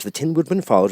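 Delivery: ordinary delivery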